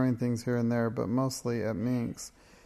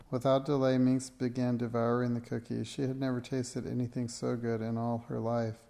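ordinary delivery, nothing marked

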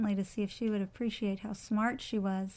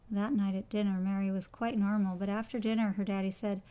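usual speaking voice

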